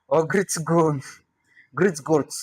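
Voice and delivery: "Gritsgo", "Gritsgort" read by the letters, with r and ts; guessed pronunciation. Shown as a demonstration. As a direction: try out "Gritsgort" with a hard ts cluster. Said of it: "let's see how to pronounce it".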